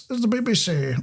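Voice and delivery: put-on BBC announcer RP; exaggerated received pronunciation; mock RP. Presenter teasing co-host about her accent, mock RP. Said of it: "You're not sound like this".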